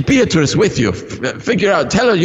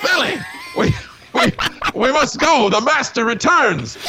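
high alarmed voice; 9 semitones higher than usual. Acted. Servant warns master returns.

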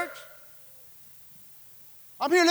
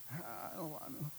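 put-on whiny kid; 11 semitones lower, 26 dB quieter than usual. Kid tugging at parent, whining.

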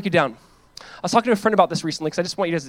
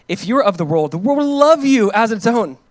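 emphatic reading; 6 semitones higher, 8 dB louder than usual. Acted. Reading Jesus' words emphatically.

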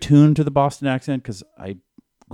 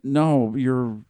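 ordinary talk, nothing marked

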